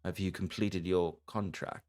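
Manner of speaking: front desk clerk. Acted. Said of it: "he would kinda look to you all and be like".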